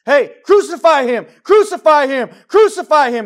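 high, full-force shouted chant; roaring, drawn-out cry of condemnation; crowd's shout. Acted. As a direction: bellow it like a mob demanding an execution. Acts out crowd rejecting Christ.

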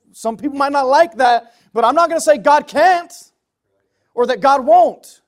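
high, loud, insistent sermon voice; emphatic, impassioned pulpit cry; full-force preaching. Real, not acted.

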